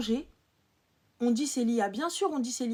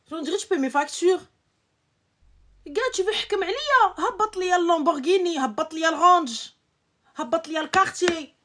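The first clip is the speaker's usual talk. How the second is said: loud, raised-pitch tirade; exasperated ranting; 4 semitones higher, 6 dB louder than usual. Real, not acted. Ranting about obeying a man without luxury gifts, exasperated. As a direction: rant it out, drawing out the brand names.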